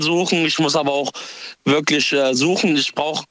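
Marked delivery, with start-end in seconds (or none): none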